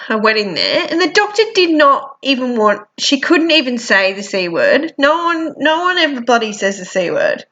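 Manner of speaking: ordinary talk, nothing marked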